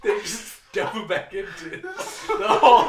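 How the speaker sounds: high, loud laughter through the words; laughing out the grim ending. Real, not acted. Laughing story end.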